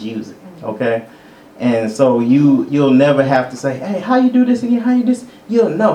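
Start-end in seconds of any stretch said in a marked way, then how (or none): 3.8-5.2 higher, quick questioning. Acted. Imagined believer asking God's guidance, lightly voiced questioning.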